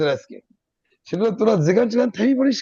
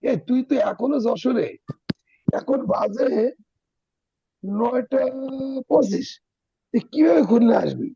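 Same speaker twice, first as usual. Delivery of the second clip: friend on the phone; 6 semitones higher than usual. Retold or imitated. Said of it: "তুই বলতেছিস".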